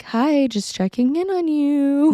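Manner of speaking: high, slow singsong greeting; imitated checking-in mom. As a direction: coo it like a concerned mom. Mother's visit, voiced.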